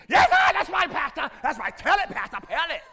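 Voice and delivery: loud, high eager agreement; shouting agreement at the pastor; amen-corner congregant. Retold or imitated. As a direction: egg the pastor on loudly. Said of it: "mimicking congregation agreeing".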